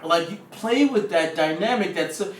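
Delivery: normal talking voice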